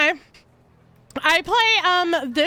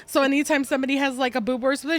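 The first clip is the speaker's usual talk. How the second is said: usual speaking voice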